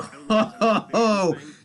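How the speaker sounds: a loud delighted laugh. Real, not acted. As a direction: burst of real laughter. Laughs at derby arrests.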